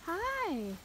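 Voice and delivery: high, drawn-out hello to an animal; pet greeting. Real, not acted. Vlogger cooing hello to the goat, pet talk.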